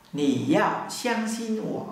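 slow Mandarin pronunciation model; slow demo. Shown as a demonstration. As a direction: drill it slowly for learners.

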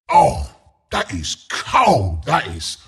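slow, low and quiet; grave disapproval. Acted. Announcer judges a cold play, gravely disapproving. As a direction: draw it out low, half under the breath.

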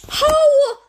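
high, loud disbelieving how; loud, high outraged question; shocked shout. Real, not acted. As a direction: yell it in total disbelief. Shocked at a game kill.

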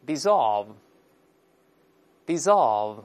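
dissolve, dissolve said slowly and clearly; slow read aloud. Shown as a demonstration. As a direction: model dissolve, dissolve for repetition. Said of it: "读一下动词原形融化".

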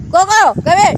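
loud, high call to a pet; calling the dog. Real, not acted. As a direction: call out for the dog to come back. Calls Coco the dog.

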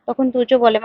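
ordinary delivery, nothing marked

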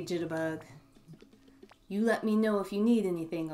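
put-on folksy country out-of-towner accent; hearty, folksy accent for a welcoming local. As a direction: play a friendly old-timer with a country accent. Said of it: "give him an accent".

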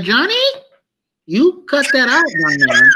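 mock prim parent warning a child; stern parent. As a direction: scold little Johnny like a prim parent. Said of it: "I said".

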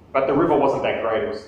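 usual speaking voice

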